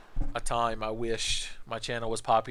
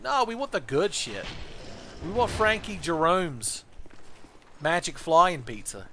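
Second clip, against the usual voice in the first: cocky student; 5 semitones higher than usual. Acted. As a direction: demand it like a spoiled kid. Student demands fancy pizza.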